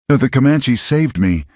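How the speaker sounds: high, firm declaration; declaring. A narrator's reading. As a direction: declare it proudly. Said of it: "she declared".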